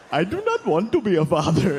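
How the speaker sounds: dad in a put-on Indian accent; badly guessed Indian accent, hammed up; mock Indian accent. Son imitating his absent father, mock Indian accent. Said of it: "a fun racist impression of him".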